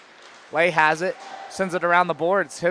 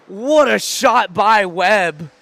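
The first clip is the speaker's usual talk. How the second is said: excited praise; 3 semitones higher, 6 dB louder, slower than usual. Real, not acted. After the goal.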